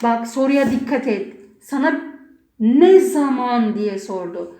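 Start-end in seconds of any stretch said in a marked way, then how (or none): 2.6-3.7 Ne zaman said slowly, stretched out; slow, drawn-out "Ne zaman" with weight on ne; stress on NE ZAMAN. Shown as a demonstration. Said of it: "Sana zaman soruyor soru".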